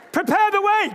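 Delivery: high, forceful call of "Prepare the way"; emphatic declaration. Shown as a demonstration. Preacher leading the congregation, emphatic call. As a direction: declare it over your neighbour. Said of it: "a bit more emphatic about this".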